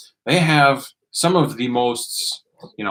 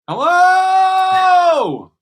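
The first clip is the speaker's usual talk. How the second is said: high, loud call into the void; 5 dB louder than usual. Real, not acted. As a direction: shout hello to be heard. Mic check calling out.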